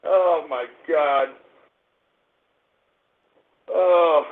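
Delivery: low, slow, soft groan; weary despair. Real, not acted. Host worn out after his meltdown, weary despair. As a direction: let it drop out, drained.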